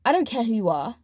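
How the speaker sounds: ordinary talk, nothing marked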